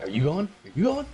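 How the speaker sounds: high, rising, insistent question; eager racer. Acted. Player to the guy beside him at the start, eager high-pitched asking. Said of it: "look over at the guy like".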